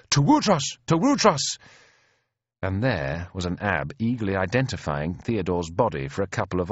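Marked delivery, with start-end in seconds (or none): 0.1-1.6 high, excited repeated call; crying out. A narrator's reading. Onlookers identifying the dead king, crying out. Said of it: "at a cry of".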